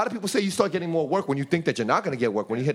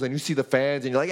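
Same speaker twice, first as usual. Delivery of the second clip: ordinary talk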